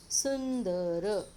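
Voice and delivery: सुंदर very slow, with a long n after सु; n sound shown. Shown as a demonstration. Teacher showing children the anusvara, सुंदर stretched on its n. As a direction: draw out सुंदर loudly, holding the n. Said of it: "न आया इसका pronunciation".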